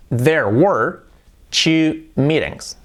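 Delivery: slow, careful teacher model; slow model sentence. Shown as a demonstration. Teacher models the English line, slow and careful. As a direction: say it slowly so they can copy. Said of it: "¿Cómo sería "hubo dos reuniones"?".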